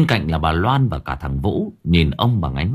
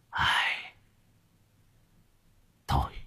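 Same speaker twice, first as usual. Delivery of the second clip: low, faint, insistent murmur; sigh, then feebly plead to be let go; weary sick old man; 7 semitones lower, 7 dB quieter than usual. Acted. (giọng ông già mệt mỏi, nhỏ và trầm)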